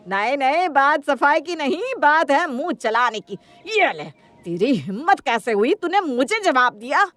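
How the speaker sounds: loud, high-pitched tirade; angry scolding. Acted. Mother-in-law beating her daughter-in-law for answering back, furious scolding. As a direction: lash out in outrage at being answered back.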